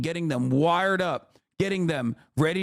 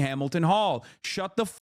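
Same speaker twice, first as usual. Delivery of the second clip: ordinary delivery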